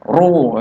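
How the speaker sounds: "Ro" with lips shoved forward; exaggerated rounded vowel in "Ro"; over-rounded wrong ro. Shown as a demonstration. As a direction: purse "Ro" toward a roo sound. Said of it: "ini goyang mulut kita ke depan".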